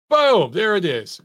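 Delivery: sudden high, loud burst; explosive call of a knockout hit; loud exclamation. Real, not acted. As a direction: punch the word out as the blow lands. Calling the knockout punch on replay.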